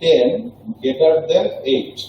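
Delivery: slow model pronunciation; slow demo reading. Shown as a demonstration. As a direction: read it slowly for the class. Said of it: "এটার উচ্চারণে এটার নামটা কি আসে দেখেন".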